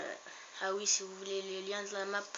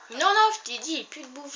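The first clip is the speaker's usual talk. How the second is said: exasperated; 7 semitones higher, 13 dB louder than usual. Real, not acted. Arguing with Thomas.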